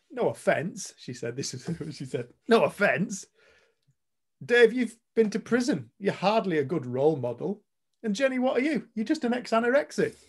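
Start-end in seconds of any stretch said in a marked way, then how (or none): 0.1-0.9 higher, louder mock retort; pointed, lifted brush-off; defiant teen retort. Quoting a defiant girl. 2.5-10.1 high, loud, cutting mock challenge; loud, pitched-up retold tirade; imitated defiant attack. Quoting a girl's blunt attack.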